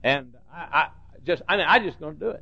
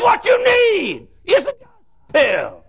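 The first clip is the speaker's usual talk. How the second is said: high, loud, drawn-out preacher's list; old preacher preaching on pills to the church; shouting old preacher; 5 dB louder, slower than usual. Retold or imitated. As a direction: hammer each pill like a fired-up preacher. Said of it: "one old preacher got up".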